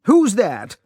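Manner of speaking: startled lord snaps at the hidden intruder; angry. A narrator's reading. Startled lord calls into the dark hut, sharp and angry. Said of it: "He spoke angrily".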